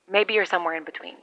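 ordinary delivery

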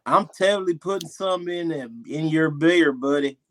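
put-on country accent; imitated country drawl. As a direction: do a country twang. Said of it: "This is T. This is you".

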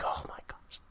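hushed whisper of disbelief; awed whisper. Retold or imitated. Realizing he does mean it, whispered in disbelief. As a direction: whisper it as a retold shocked reaction.

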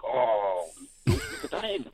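imitating let-down fans, drawn-out oh; disappointed. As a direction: act out their disappointment on hearing it. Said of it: "they're looking at me with disappointment".

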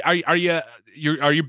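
ordinary delivery

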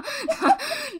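loud high laugh; real laughter over the silly itinerary; high burst of laughter. Real, not acted. Laughing hard.

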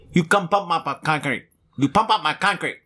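a hint of the foreign caller's broken English; lightly mimicking a foreign accent on the phone; mock foreign accent.

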